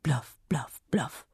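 soft imitated dachshund barks; little dachshund woofing at nobody in particular; dog barking. A narrator's reading. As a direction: bark like a small dog, fading out.

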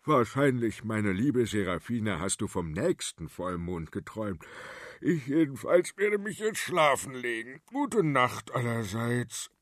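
a hint of a bored old king; sleepy old king. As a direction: shrug it off and head for bed. King Ladislaus dismisses it.

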